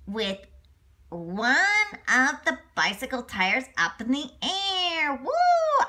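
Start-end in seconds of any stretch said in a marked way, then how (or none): 5.2-5.8 high, loud woo; gleeful woo. Acted. George riding on one wheel, cheering. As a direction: cheer like on a ride.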